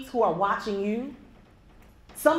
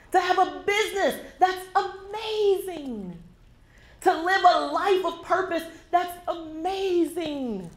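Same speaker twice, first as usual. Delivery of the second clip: impassioned preaching; 8 semitones higher, slower than usual. Real, not acted. Impassioned closing exhortation.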